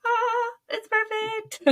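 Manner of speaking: very high, slow delighted sigh; dreamy, drawn-out delight; blissful sigh. Retold or imitated. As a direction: melt with relief. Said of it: "And it was just like".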